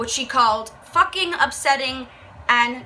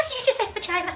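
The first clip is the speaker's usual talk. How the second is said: mock kids; 9 semitones higher, 5 dB quieter, faster than usual. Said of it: "We're like".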